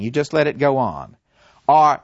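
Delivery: ordinary delivery, nothing marked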